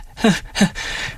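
high laugh slipping out; short high giggling fit; uncontrolled laugh. A narrator's reading. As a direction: laugh without meaning to. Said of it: "Hvem er det, der ler".

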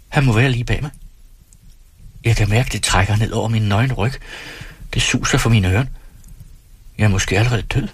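plain reading voice

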